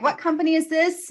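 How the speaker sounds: high, baffled mock voice of the caller; high confused caller. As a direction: ask it high and puzzled, like a lost caller. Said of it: "She's like".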